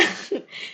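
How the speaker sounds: quick unvoiced laugh; breathy laugh. Real, not acted. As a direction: laugh at the memory. Risa tras la anécdota. (risita sin voz)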